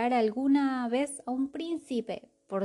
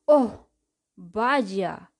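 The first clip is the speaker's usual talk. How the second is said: surprised gasp; 8 dB louder than usual. Acted. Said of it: "fue lo único que dijo el mago real".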